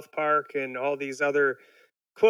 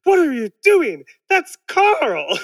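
much higher and louder indignant cry; outraged Burns; 9 semitones higher, 7 dB louder, slower than usual. Retold or imitated. Burns defending Carl from the pudding, in a much higher louder outraged cry. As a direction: yelp it in sudden outrage. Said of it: "completely changes his attitude".

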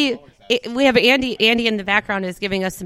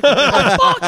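loud, raised bird voice breaking into laughter; swearing cockatiel; 6 semitones higher, 8 dB louder than usual. Retold or imitated. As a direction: imitate the bird, laughing through it. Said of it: "the cockatiel was like".

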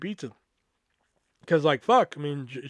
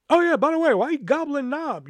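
mocking high voice; 10 semitones higher, 3 dB louder than usual. Mock afterthought question to friend.

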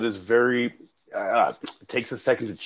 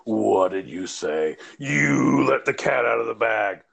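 low, slow mock dread; mock inner self-scolding; 5 semitones lower than usual. Replaying therapy regrets on the walk to the car, low slow mock dread. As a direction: beat yourself up in a low drawn voice.

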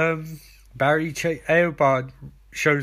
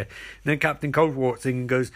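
ordinary talk, nothing marked

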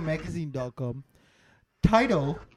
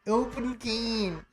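slow, higher-pitched American accent; 6 semitones higher, much slower than usual. Shown as a demonstration. Showing friends the contrast, said in an American accent. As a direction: stretch it out in American. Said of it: "if I say it in an American accent".

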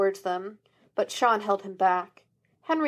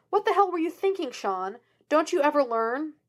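angry scolding; 7 semitones higher than usual. A narrator's reading. Father scolding his son.

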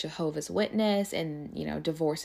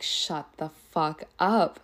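slow, drawn-out disbelief; retold disbelief; 2 semitones lower, slower than usual. Telling friends about finding his wedding albums, slow stunned disbelief. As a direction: stretch each word out, stunned.

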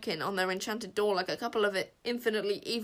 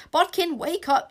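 high, slow urgent yell; 8 semitones higher, 7 dB louder than usual. A narrator's reading. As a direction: shout to wake him. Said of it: "yelled Wish".

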